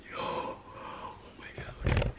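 scared whisper. Real, not acted. Scared by movement.

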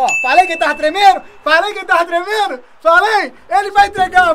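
told-you-so, shouted; loud gloating. Real, not acted. Gamer gloating at a nervous rival, loud. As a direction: crow over the opponent loudly.

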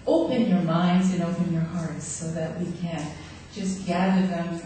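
ordinary delivery

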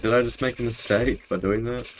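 ordinary talk

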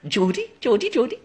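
high mock Geordie with a laugh; terrible Geordie. Shown as a demonstration. Repeating the accent's name while laughing, bad mock Geordie. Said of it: "That was terrible".

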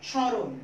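স্মরণ said as শঁরণ, the ম silent and the স nasalised; nasal স্মরণ. Shown as a demonstration. Teacher showing pupils how to say স্মরণ, nasal স. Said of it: "যেমন আমি দিচ্ছি".

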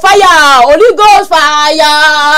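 slow, loud, drawn-out prayer-warrior cry; mock fire prayer. Joking with her friend, a mock fire-prayer preaching cry. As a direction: call down Holy Ghost fire like a preacher.

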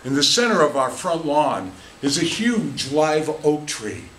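normal talking voice

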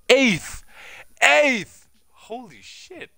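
high, loud stunned cry; outraged exclamation; disbelieving shout. Real, not acted. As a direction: yell at the unfair result. Outrage at placement.

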